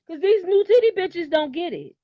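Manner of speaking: high, loud and fast rant; fired up. Real, not acted. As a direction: get heated and let it rip. Heated rant, keeping it real.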